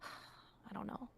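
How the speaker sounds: breathy exhausted whisper. Real, not acted. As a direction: sigh it out under breath. Exhausted sigh in argument.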